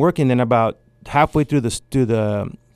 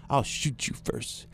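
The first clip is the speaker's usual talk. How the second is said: slow, very soft menacing line; deadpan threat; 12 dB quieter, slower than usual. Retold or imitated. Girlfriend threatening him over coffee, slow and soft.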